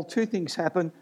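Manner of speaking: ordinary talk, nothing marked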